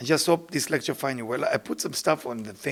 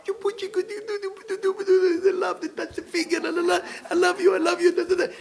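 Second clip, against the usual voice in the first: high sing-song la la la of sweethearts; mock lovey-dovey couple. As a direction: parody a couple cooing at each other. Mocking a loving couple.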